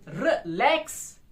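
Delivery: Relax slowly, light रि and strong lakhs; relax split in two, weight on lakhs; weak-strong stress. Shown as a demonstration. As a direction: lean on the second syllable of relax. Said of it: "दूसरा strong हो रहा है".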